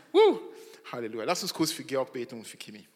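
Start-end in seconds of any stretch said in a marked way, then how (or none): 0.1-0.4 high, loud joyful woo; excited whoop. Real, not acted. Pastor celebrating the couple's baby, a loud whoop. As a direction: cheer out for the newborn.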